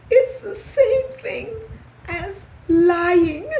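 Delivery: high, tearful mouse voice; small, miserable squeak; unhappy little mouse. A narrator's reading. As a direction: explain it in a squeaky whimper. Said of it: "said the unhappy little mouse".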